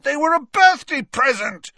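high, loud, indignant shout. A narrator's reading. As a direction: yell it back defensively. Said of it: "shouted Ron".

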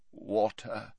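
low, faint croak; Dumbledore croaking. A narrator's reading. Dumbledore coming round, weak croak. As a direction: croak it out weakly. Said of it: "croaked Dumbledore".

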